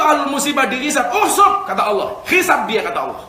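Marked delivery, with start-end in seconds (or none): 1.1-1.6 loud, high sudden order; commanding. Acted. God orders the angels to begin reckoning, commanding. 2.3-2.8 raised, louder order; commanding. Acted. God orders angels to judge the afflicted, commanding.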